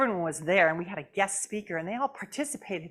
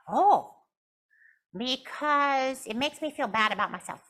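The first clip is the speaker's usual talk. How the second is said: puppet voice; 3 semitones higher than usual. Acted. Puppet Jasmine.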